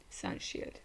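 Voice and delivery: Sun Shield breathed out with no voice; whispered repeat. Acted. Reacting to the anime, whispering the attack name back. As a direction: murmur Sun Shield to yourself.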